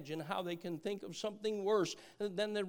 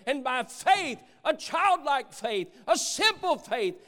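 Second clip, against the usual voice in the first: louder, higher, punching each phrase; fervent, raised-voice testimony; emphatic preaching; 5 semitones higher, 6 dB louder than usual. Real, not acted.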